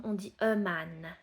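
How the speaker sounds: "a man" with a clear open A vowel. Shown as a demonstration. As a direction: say "man" with an A like in "chat". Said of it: "ça se prononce A".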